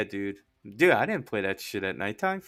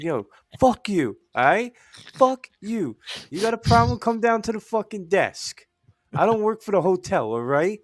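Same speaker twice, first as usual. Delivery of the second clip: higher-pitched, indignant retort; mock angry comeback; 7 semitones higher than usual. Valet telling off an angry caller, mock indignant. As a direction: retell a heated phone challenge.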